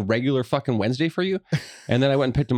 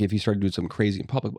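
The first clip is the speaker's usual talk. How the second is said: ordinary talk, nothing marked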